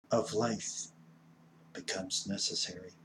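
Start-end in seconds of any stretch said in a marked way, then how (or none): none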